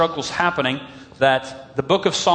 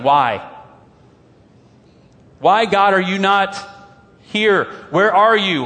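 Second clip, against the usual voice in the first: raised, higher, desperate calling out; anguished cry to God; 5 semitones higher, 5 dB louder than usual. Retold or imitated. Pastor voicing a sufferer crying out to God, anguished and loud.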